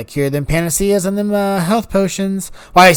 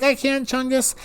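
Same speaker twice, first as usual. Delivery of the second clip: higher excited telling-off; 7 semitones higher, 4 dB quieter than usual. Retold or imitated. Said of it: "I'm like".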